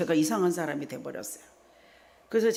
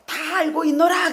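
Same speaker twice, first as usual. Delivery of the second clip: laughing divine voice; 10 semitones higher, 7 dB louder than usual. Retold or imitated. 하나님의 선언.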